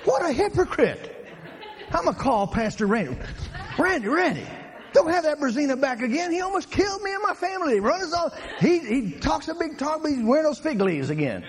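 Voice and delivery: high, hammed-up outraged complainer; angry driver. Angry churchgoer phoning the pastor about a reckless driver. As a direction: whine it like a scandalized church member.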